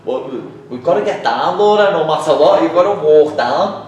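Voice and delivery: retold exasperated shout; exasperated urging. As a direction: insist loudly, at the end of your patience. Retelling his exasperated plea to Laura.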